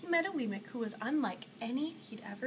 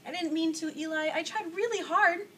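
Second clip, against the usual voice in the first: louder, higher, anxious to explain; pleading protest; 6 semitones higher, 7 dB louder than usual. Acted. Punchinello defends himself to Eli in a loud, pleading voice. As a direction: beg him to understand.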